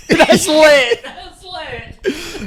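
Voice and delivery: laughing through the words; can't stop laughing as you say it. Real, not acted. Laughing reaction.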